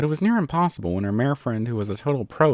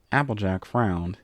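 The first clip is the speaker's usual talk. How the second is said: ordinary delivery, nothing marked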